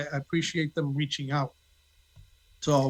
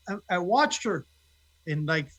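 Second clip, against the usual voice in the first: usual speaking voice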